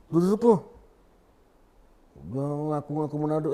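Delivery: a hint of an accusing shout; mild angry neighbour.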